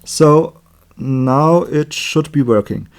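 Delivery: ordinary talk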